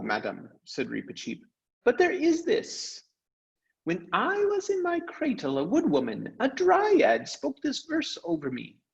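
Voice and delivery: a tiny gallant mouse speaking with lofty pride; Reepicheep, brave mouse. Acted. Mouse tells the crew of his quest, high and noble.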